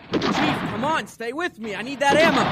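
calling out under fire. Acted. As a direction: yell for your ammo carrier to keep up.

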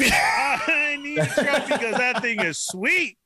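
high, drawn-out hammed-up cry; mock desperate excitement. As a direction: wail it like a frantic would-be buyer. Mocking a future desperate truck buyer.

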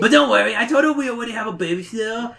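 put-on high, rushed little-kid whine; Stinko. A narrator's reading. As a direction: prattle on as the dopey little brother. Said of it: "Stinko replies".

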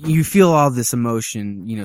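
ordinary talk, nothing marked

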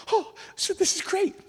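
high, drawn-out mock excitement; retold amazement. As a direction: act out a thrilled discovery, high and slow. Retold reaction to learning name.

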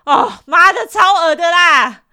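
screaming in disgust; disgusted shout. Real, not acted. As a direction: yell out your revulsion. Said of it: "disgusted outburst".

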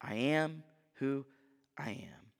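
soft, slow, fading reverence; quiet, awed delivery of the divine words; hushed reverent. Acted. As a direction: let it fall to near silence in awe. Solemn quoted divine name.

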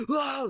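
high-pitched startled scream; mock scream. Overwhelmed player screaming at the voices, high mock scream. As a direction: let out a brief high cry. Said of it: "Så bara skriker man bara".

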